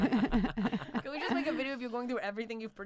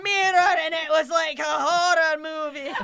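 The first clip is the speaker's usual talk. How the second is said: high, loud, slow Scottish monologue; Scottish accent; 7 semitones higher, 7 dB louder than usual. Retold or imitated. Comic re-enacting her monologue over laughter, wailing Scottish accent. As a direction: wail it out in a hammed-up Scottish accent. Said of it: "vagina monologues in a Scottish accent".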